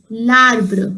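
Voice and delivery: "larbre" as one word, L attached to arbre; joined-up French pronunciation. Shown as a demonstration. Teacher showing the sound along with L apostrophe, careful "larbre". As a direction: say "larbre" slowly with no gap after the L. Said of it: "along with L apostrophe".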